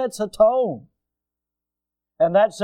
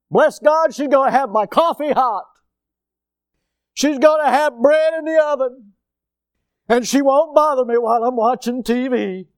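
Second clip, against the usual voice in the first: mock smug husband; 6 semitones higher, 4 dB louder than usual. Acts widower expecting service in heaven.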